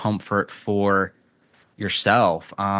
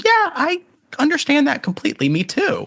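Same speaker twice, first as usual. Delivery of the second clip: much higher, light, half-laughing; amused sympathy. Real, not acted. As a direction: squeal your agreement, much higher. Sympathizing with anxious meditator.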